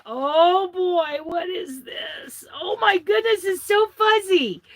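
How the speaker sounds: high, gushing wonder; thrilled discovery, high and gleeful; delighted amazement. Real, not acted. Amazed on opening the card.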